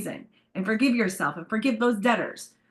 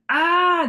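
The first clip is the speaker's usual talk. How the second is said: a loud, high exasperated "ah"; 10 semitones higher, 8 dB louder than usual. Retold or imitated. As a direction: burst out with an annoyed "ah". Ego and pride start talking.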